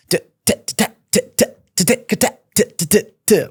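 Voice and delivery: quick unvoiced t-t-t beatbox clicks; mouth beat. Shown as a demonstration. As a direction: tap out a swing beat with the tongue. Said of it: "they were all swing beats".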